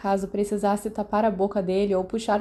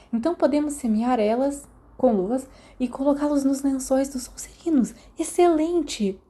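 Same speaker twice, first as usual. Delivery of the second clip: gleeful scheming; 4 semitones higher than usual. Acted. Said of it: "Sirius sorriu".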